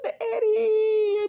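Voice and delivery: wailing, very high, hammed-up sob; mock crying child. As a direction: blubber it like a little girl. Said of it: "she comes up crying".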